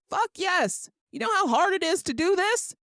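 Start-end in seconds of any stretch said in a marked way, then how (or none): 0.1-0.9 much higher, delighted yes; mock eager acceptance. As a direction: jump at it, thrilled. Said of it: "I'd be like".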